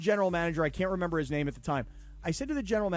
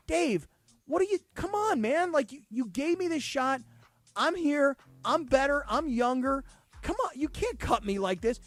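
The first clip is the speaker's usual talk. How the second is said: re-enacted protest in a raised pitch; emotional pleading; 6 semitones higher than usual. Cut kicker pleading with his coach, emotional. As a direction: plead your case, worked up and higher. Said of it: "I was very emotional".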